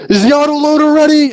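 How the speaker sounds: high, loud shout over engine noise; yelling soldier. Retold or imitated. Scout in speeding vehicle yelling to gunner, urgent shout. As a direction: yell it up to the turret. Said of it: "as they're yelling back and forth".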